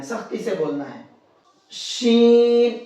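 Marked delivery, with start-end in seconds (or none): 1.7-2.8 شین drawn out with a whistling hiss; whistled sheen. Shown as a demonstration. Teacher showing students the whistle in شین. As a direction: let the شین whistle through the teeth. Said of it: "شین کے اندر سیٹی ہوتی ہے".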